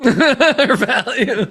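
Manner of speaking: slow laughing repeat. Real, not acted. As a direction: giggle the words out.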